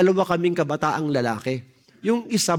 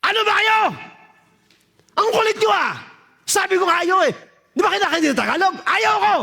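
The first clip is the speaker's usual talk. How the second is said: yelled refusal; 14 semitones higher than usual. Retold or imitated. Young addict shouting at the persistent preacher woman, fed up. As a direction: yell at her to back off. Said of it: "Sumigaw ako".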